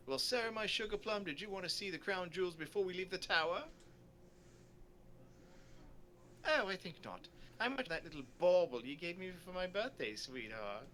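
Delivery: storybook sweethearts in light character voices; lilting, lightly acted endearments; doting tourist couple. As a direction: play affectionate sightseers. Voices tour characters in dialogue.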